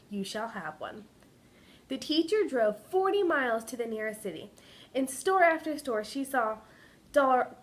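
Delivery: expressive read-aloud storytelling voice; story narration. A narrator's reading. Teacher reading a picture book to kindergartners, story narration. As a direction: tell it like a picture book to little kids.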